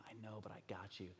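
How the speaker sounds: quick, hushed whisper; reassuring father. Retold or imitated. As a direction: whisper comfort to a frightened child. Father reassuring his frightened daughter.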